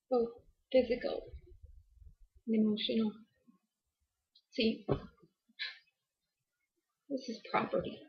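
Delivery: soft, halting delivery with long pauses; hushed confession. Acted. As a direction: confide it quietly, a few words at a time. Halting confession of marital abuse.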